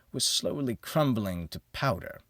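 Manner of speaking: plain reading voice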